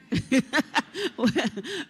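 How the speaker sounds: high, light giggle at herself; embarrassed giggle. Real, not acted. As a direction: laugh off the forgotten name. Laughs at her own remark.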